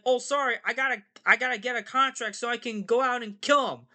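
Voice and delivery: high, apologetic frog voice; Kermit. Acted. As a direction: explain yourself in a high, hurried-sounding puppet voice. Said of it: "And Kermit's like".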